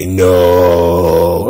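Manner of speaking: deadpan low "no". Retold or imitated. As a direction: drop the voice way down for a dry "No". Deadpan reply in retelling.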